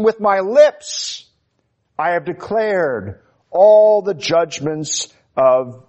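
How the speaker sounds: high, slow declaration of scripture; crying out. Acted. Preacher quoting the verse as a heart's outcry, crying out. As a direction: proclaim the verse, pitched high and drawn out. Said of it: "so you cry out with verse thirteen".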